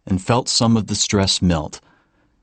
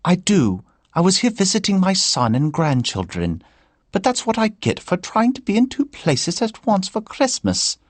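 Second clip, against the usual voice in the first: put-on high Irish old woman's voice, pitched well up; a chatty Irish grandmother complaining lightly; Irish lilt of an older woman; Irish accent; 12 semitones higher than usual. A narrator's reading. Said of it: "in an Irish accent".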